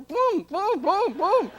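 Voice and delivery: high, punchy "pum" noises in a row; vocal sound effect of sudden jolts; imitated thumping. Imitates sudden twitching during the photo.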